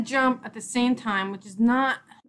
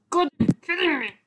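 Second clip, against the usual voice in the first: high, disbelieving cry; exasperated outburst; 8 semitones higher, 4 dB louder than usual. Real, not acted. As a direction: burst out, pitched up in frustration. Exclaims at failing in game.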